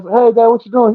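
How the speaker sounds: high, loud, excited hello; eager greeting. Retold or imitated. Son calling his dad with big news, excited. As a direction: act out bursting onto the phone.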